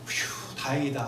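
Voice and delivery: soft and slow, a hushed sigh of relief. Retold or imitated. David hiding his relief from the king, soft and slow. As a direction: breathe out the relief quietly, almost to yourself. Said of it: "하면서도요".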